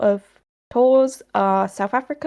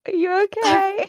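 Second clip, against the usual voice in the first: laughing; 10 semitones higher than usual. Real, not acted. Laughing with crying friend.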